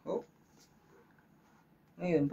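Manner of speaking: ordinary talk, nothing marked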